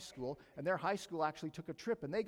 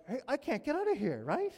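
retold mild panic; 9 semitones higher, 7 dB louder than usual. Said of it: "And so I'm like".

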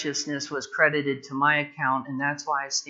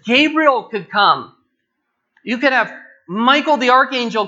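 loud, high, driving pulpit voice; full-force preaching; 6 semitones higher, 8 dB louder than usual. Acted. Preacher warning the church against another gospel, loud and driving.

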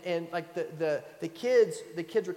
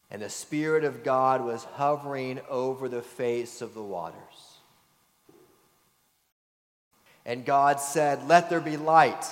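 scripture reading; 3 semitones lower, 3 dB louder than usual. Acted. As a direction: read it like Holy Scripture to the congregation. Formal scripture reading aloud.

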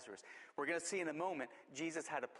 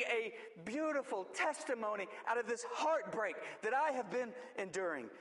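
raised, driving altar-call delivery; fervent preaching; 5 semitones higher than usual. Acted. Pastor urging congregation to the altar, fervent raised preaching. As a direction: preach it out, pushing them forward.

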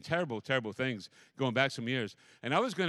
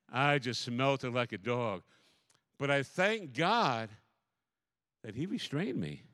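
ordinary talk, nothing marked

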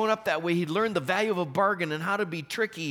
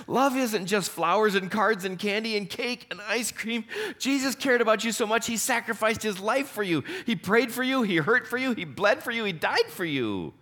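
building sermon; 6 semitones higher than usual. Acted. Preaching climax on sacrifice.

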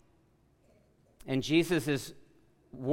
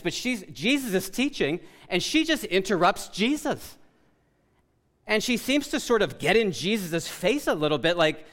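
normal talking voice

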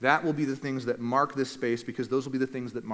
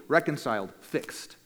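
normal talking voice

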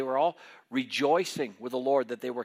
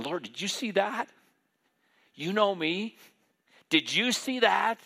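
high, eager voice of Peter telling God; gushing like a thrilled fisherman, pitched up; excited Peter; 7 semitones higher, 4 dB louder than usual. Retold or imitated. Peter proudly telling the Lord about his boldness, excited and high.

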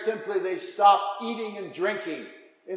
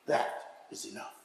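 breathy hush; 9 semitones lower, 14 dB quieter than usual. Real, not acted. Quiet awe after story.

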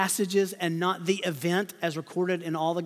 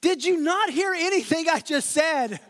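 exasperated retort; 7 semitones higher, 3 dB louder than usual. Pretend. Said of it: "I would have said".

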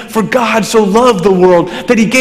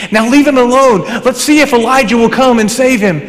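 higher-pitched mock onlooker; bystander calling out; 6 semitones higher than usual. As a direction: imitate a scoffer waving others off. Acting out bystanders at the cross.

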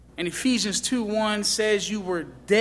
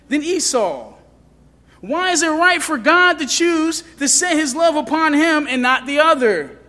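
higher, louder voice of a doubter; protesting objector; 5 semitones higher, 5 dB louder than usual. Acted. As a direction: argue back as a challenging listener. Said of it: "But then you say".

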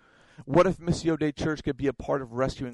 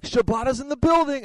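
much higher and louder, hammed-up announcement; grand entrance; 11 semitones higher, 7 dB louder than usual. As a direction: herald yourself into the room. Friend announces his entrance.

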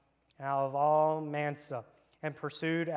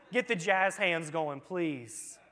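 ordinary talk, nothing marked

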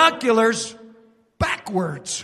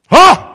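a loud, high scornful huh; mocking grunt; 6 semitones higher, 13 dB louder than usual. Preacher voicing Satan to the congregation, scornful loud huh. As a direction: grunt it out like a gloating devil.

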